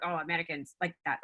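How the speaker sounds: low, soft attempt at a Japanese accent. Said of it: "my best Japanese accent".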